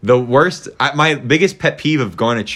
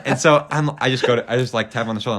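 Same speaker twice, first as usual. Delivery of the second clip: usual speaking voice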